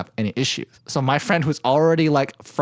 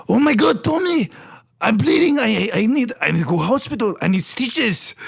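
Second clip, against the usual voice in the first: high-pitched comic panic, stammering; panicked older coworker impression; 9 semitones higher than usual. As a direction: wail it in your coworker's silly high voice. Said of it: "that was, like, the way that he spoke to us".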